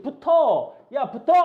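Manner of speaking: playfully bossy shout; mock command. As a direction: call them to stick together, high and punchy. Voices a command to chromosomes.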